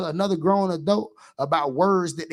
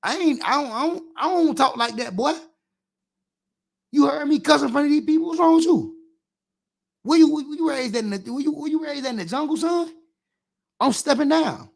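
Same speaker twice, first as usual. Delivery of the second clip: put-on scolding a child; 7 semitones higher than usual. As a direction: tear into the kid about cussing. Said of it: "Step down on your ass".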